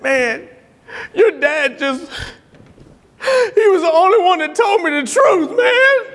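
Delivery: high, hammed-up tearful voice; imitated crying.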